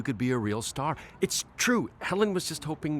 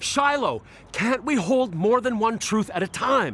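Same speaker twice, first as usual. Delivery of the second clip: exasperated; 8 semitones higher, 4 dB louder than usual. Acted. Pleading in argument.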